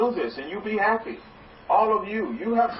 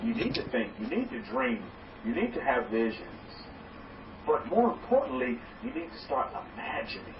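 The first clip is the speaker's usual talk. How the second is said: hushed urging; 5 semitones lower, 8 dB quieter than usual. Acted. Building to a hushed point.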